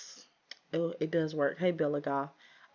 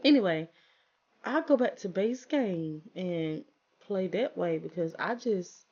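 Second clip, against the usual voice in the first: normal talking voice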